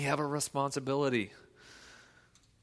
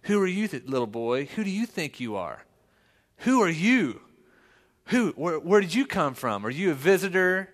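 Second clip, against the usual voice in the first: higher and louder, sneering little bully; mock bully taunt; 5 semitones higher, 5 dB louder than usual. Pastor imitates a bully taunting his son, louder and higher. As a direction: taunt him like a playground bully. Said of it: "start saying things like him".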